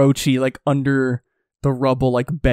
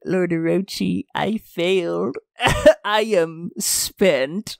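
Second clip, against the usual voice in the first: feeble, slow, breaking voice; weak dying retainer; 2 semitones higher, slower than usual. Retold or imitated. Co-host voices dying Kazunbo to Orochi, feeble and tearful. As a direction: gasp it out like a spent little man. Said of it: "little Kazunbo, like".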